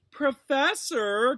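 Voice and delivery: high, slow, sneering drawl. Acted. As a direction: stretch it out mockingly. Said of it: "drawled Malfoy".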